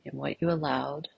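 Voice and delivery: soft, unhurried meditation voice with long pauses; guided meditation. Real, not acted. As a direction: gently guide listeners inward, leaving silence between phrases.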